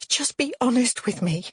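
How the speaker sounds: high, upset plea; pleading. A narrator's reading. Woman begs man to be honest, pleading. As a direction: beg for the truth.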